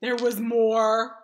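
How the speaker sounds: loud, eager recounting; gushing about a cool robot; excited telling. Acted. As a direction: burst out with the best part.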